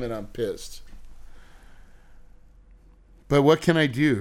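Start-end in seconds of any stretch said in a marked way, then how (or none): 0.0-0.8 much softer, muttered under the breath; quiet seething mutter; muttered anger. Real, not acted. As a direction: grumble it to yourself, seething. Fuming, voice drops away.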